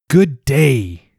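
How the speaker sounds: loud, high call across the town; ringing greeting. A narrator's reading. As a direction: boom the greeting out to everyone. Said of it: "His voice rang across town".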